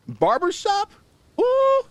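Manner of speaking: very high, loud falsetto "ooh"; mock barbershop falsetto. Streamer reacting to the word barbershop, in mock singer's falsetto. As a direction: leap up into a playful falsetto hoot.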